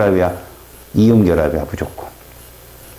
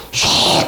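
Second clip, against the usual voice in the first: whispered whoosh; much slower than usual. Shown as a demonstration. Imitating reaction noise.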